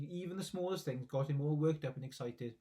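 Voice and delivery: usual speaking voice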